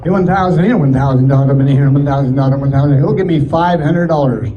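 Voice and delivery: ordinary talk, nothing marked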